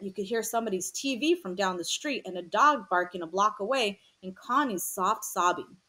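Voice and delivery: plain reading voice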